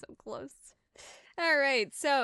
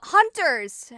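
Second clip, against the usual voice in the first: loud, higher wolf's alarm cry; yelling out; 8 semitones higher, 11 dB louder than usual. Acted. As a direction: yell a warning to the pack. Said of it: "one of the wolves yells out".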